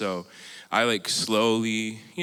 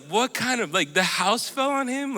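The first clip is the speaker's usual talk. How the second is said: high, rising incredulous guesses; baffled disbelief; 12 semitones higher, 4 dB louder than usual. Retold or imitated. Retelling his puzzled guesses to the panel, pitched high. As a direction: wonder aloud what could possibly fall on him. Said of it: "I was like".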